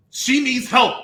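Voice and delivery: usual speaking voice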